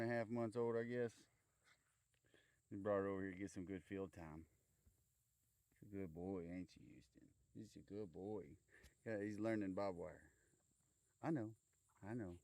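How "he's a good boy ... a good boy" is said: soft praise to dog. Real, not acted. Praising his dog Houston.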